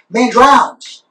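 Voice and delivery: ordinary delivery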